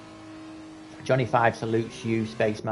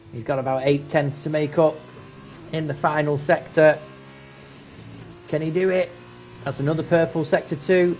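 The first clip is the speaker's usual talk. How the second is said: rising excitement; 4 semitones higher than usual. Real, not acted. Commentator calling the leader's last lap charge, building excitement. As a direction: call the last lap chase with mounting thrill. Final lap chase to the line.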